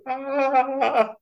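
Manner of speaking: long, very high held "aah"; drawn-out mock wail of struggle; comic wail. Strains while juggling the worm.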